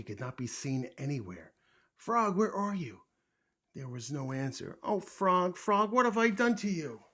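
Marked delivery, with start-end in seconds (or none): 1.9-3.0 high, loud search call; worried calling. A narrator's reading. Toad calls for vanished Frog, anxious. 4.8-7.0 high, loud lament; distressed cry. A narrator's reading. Toad fears he shrank Frog away, distressed.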